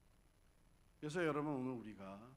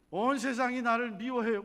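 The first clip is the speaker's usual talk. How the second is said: put-on upset child; 11 semitones higher, 15 dB louder than usual. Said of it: "그러는 거야".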